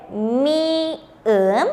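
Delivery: "mi-en" slowly, in two separated syllables; letter name sounded out. Shown as a demonstration. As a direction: stretch "mi-en" syllable by syllable. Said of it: "es la consonante". ("mi-en" lento, sílaba por sílaba)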